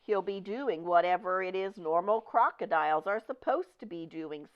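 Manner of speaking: usual speaking voice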